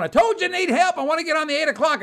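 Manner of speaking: high, loud, fast insisting; acting out a worked-up traveler pleading; agitated insistence. Joe insisting in airport dispute.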